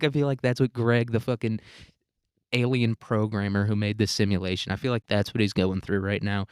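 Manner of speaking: ordinary talk, nothing marked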